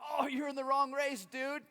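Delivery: loud, high, laughing tease; amused old runner. Retold or imitated. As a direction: break the news with a laugh. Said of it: "and he goes".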